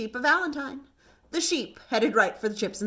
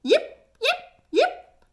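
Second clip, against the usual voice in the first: very high, louder fox yips; fox yipping; 12 semitones higher, 5 dB louder, much slower than usual. A narrator's reading. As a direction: call out like a little fox. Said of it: "she called".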